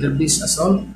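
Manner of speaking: ordinary talk, nothing marked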